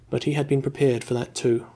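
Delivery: plain reading voice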